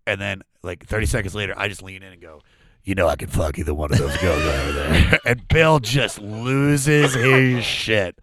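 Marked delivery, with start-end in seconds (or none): 2.8-5.2 low, even murmured boast; dropped-pitch confidential punchline; low aside. Retold or imitated. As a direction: lean in and say it low, deadpan. Leans in to Bill, deadpan punchline.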